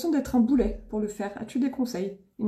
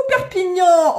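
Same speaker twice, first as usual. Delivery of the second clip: louder, higher call of the town name; excited call-out; 5 semitones higher, 6 dB louder, slower than usual. Real, not acted. Streamer greeting viewers' towns live, excited call-out. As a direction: sing out the town with delight.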